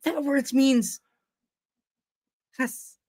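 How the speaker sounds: put-on high indignant teen voice; outraged teenager. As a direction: scold like an offended kid. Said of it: "got yelled at by, like, the teenagers".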